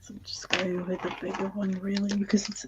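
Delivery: quick hushed whisper; fast whisper. Real, not acted. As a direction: rush it out in a whisper.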